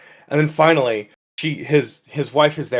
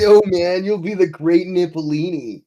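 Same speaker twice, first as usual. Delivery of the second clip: higher-pitched stoner voice; stoner capybara; 5 semitones higher, 3 dB louder than usual. Acted. Talking capybara gives the man his prophecy, stoner voice. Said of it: "can the capybara talk like a stoner?".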